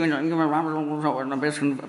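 deliberately unintelligible mumbling; mumbled demonstration. Shown as a demonstration. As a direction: mumble so nobody can make out the words. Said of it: "da muss man übrigens auch richtig artikulieren".

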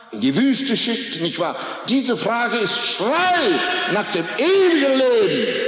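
high, intense pulpit preaching, pressing each phrase; fiery preaching. Acted. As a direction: hammer the listener's guilt from the pulpit. Preaching at full force.

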